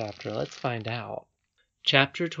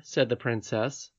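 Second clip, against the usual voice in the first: plain reading voice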